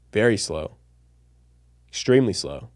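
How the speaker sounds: drawn-out words with long pauses; very slow. Shown as a demonstration. Showing viewers how slow speech gets boring, dragging. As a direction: stretch each word, leaving long gaps. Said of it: "if I slow things down".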